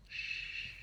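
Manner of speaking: plain reading voice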